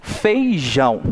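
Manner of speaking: Feijão split fei-JÃO, weight on JÃO; stress on last syllable. Shown as a demonstration. Teacher gives an oxítona example, stressing JÃO. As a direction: land firmly on the JÃO of Feijão. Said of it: "coloquei uma intensidade maior no jão".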